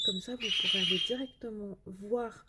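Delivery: usual speaking voice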